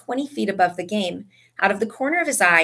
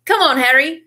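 loud, high, cheerful call; 6 semitones higher, 6 dB louder than usual. A narrator's reading. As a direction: call a friend over. George calls Harry to celebrate.

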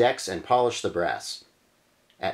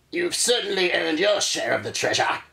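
higher hearty captain voice; ship captain voice; 8 semitones higher than usual. Acted. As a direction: praise them like a pirate captain. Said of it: "says Captain Fry".

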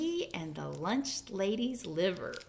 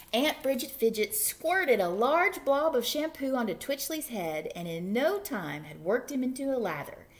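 ordinary delivery, nothing marked

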